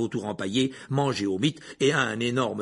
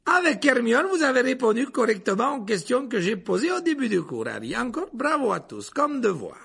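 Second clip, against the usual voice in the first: high, raised teacher's voice; enthusiastic praise; 9 semitones higher than usual. A narrator's reading. Lupin still praising the class. (félicitations d'une voix haute)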